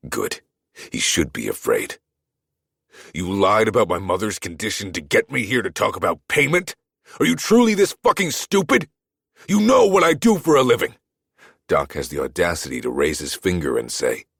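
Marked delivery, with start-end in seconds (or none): 3.1-6.8 angry confrontation. A narrator's reading. Furious at doctor. 7.2-11.0 much higher, loud furious yell; furious shout. A narrator's reading. Furious at doctor.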